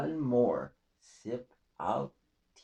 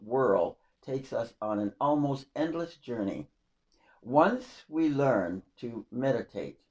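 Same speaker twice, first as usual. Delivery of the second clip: measured, solemn poetry recital; poem reading; 2 semitones higher, 5 dB louder than usual. Acted. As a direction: read it like a heartfelt verse. Reads her own poem.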